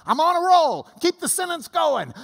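high, loud, on a roll; excited dictation. Acted. Teacher acts Paul dictating to a scribe, excited. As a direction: call it out mid-flow, loud and high. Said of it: "you can just see Paul dictating this".

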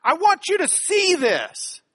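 insist, pitch up and lean in on every word; emphatic call for attention. Shown as a demonstration. Teacher voicing a Greek signal word, loud and emphatic. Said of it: "words whose real purpose is just to say".

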